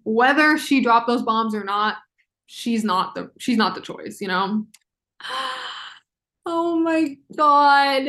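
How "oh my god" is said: sharp gasp, then a slow stunned oh my God; sudden gasp. Real, not acted. Fan reacting mid-explanation, stunned gasp. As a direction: caught off guard by her own thought. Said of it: "Okay, sorry".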